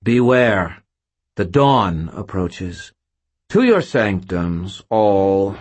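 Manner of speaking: loud, slow scornful command; contemptuous. A narrator's reading. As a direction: order them off with disdain. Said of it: "her tone full of contempt".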